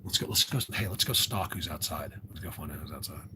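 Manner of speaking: low, whispered conspiratorial aside; hushed whisper. Real, not acted. Whispering to viewers about sneaking a look outside. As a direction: sneak the words out under your breath.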